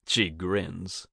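plain reading voice

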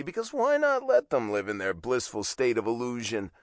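ordinary delivery

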